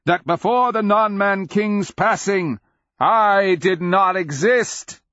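high, loud declamation slowing at the end; grand, full-voiced shout. A narrator's reading. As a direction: shout it out, stretching the final words. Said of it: "Cleric shouted".

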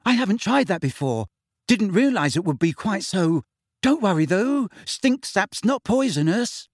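high-pitched, flustered reassurance; nervous. A narrator's reading. Neville excusing the stink sap to Harry, nervously. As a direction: explain it anxiously, pitched well up. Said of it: "he added nervously".

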